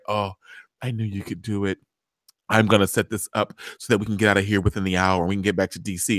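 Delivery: low, soft imitation of a man; Fitz. Recapper playing Fitz reassuring Olivia, low quiet voice. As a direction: drop low and quiet, acting the pleased fixer. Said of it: "And he's like".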